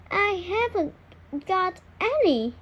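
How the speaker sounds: lightly voiced timid duckling. A narrator's reading. To the cat, duckling says he has no eggs, small high voice. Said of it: "says the ugly duckling".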